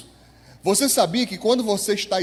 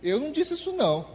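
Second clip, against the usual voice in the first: higher and fast, protesting; indignant denial; 8 semitones higher, 4 dB quieter, faster than usual. Retold or imitated. Angry person denying what they said, mock indignant. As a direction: deny it with mock outrage. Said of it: "a pessoa vai dizer".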